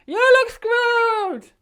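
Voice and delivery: high, loud, drawn-out shouted echo; shouting back over wind. Retold or imitated. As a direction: fire the same words back over the wind. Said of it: "she's going".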